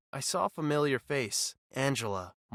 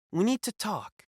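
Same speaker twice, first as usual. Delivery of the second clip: higher, grave warning tone on need; serious; 8 semitones higher than usual. Acted. Boss telling Reed they must talk, serious. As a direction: sound serious, stress need. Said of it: "her voice serious".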